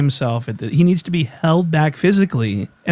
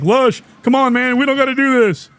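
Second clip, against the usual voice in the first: much higher, frightened mock plea; scared plea; 11 semitones higher than usual. Imitating the frightened guy pleading with Lush. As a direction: beg him to back off, scared. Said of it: "Scared and frightened. He's like".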